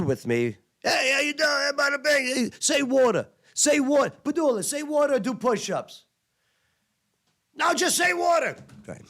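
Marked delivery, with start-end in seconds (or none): 0.8-6.1 high mock New York taunt; hammed-up ribbing from higher ranks; mocking sergeants. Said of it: "everyone screws with me because of how I talk". 7.5-8.6 high shouted taunt; hammed-up ribbing demand; mock sergeant. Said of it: "screws with me because of how I talk".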